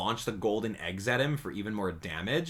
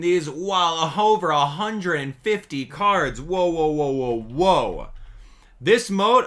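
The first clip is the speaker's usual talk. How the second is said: excited; 5 semitones higher, 6 dB louder, slower than usual. Real, not acted. Excited about card mode.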